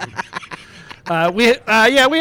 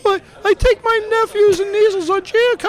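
high put-on woman's voice; female voice. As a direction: read it in a silly falsetto as a woman. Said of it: "it's, it's a female voice".

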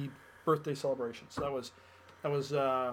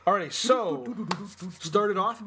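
usual speaking voice

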